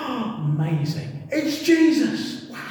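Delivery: sudden gasp then slow, high wonder; astonished gasp. Retold or imitated. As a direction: breathe in as the realisation hits. Disciples realise it is Jesus.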